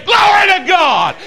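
a huge, high shout of praise. Real, not acted. Preacher proving he can out-shout the crowd, a huge high shout. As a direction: let it ring out at the top of your voice. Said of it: "and I can shout even more".

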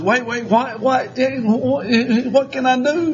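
high, flustered panic; a helpless husband scrambling to fix it; mock-panicked husband. Panicked dad at crying wife.

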